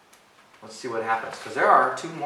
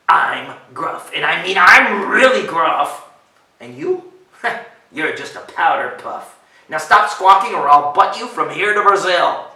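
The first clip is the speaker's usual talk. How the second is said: loud, blustering threats; bullying goat; 3 semitones higher, 12 dB louder than usual. Acted. Goat threatening little ogre under bridge, loud bully. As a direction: throw your weight around, punching the boasts.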